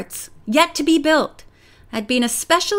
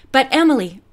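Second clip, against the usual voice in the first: usual speaking voice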